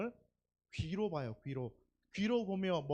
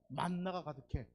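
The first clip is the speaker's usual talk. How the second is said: ordinary talk, nothing marked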